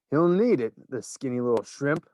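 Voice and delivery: ordinary delivery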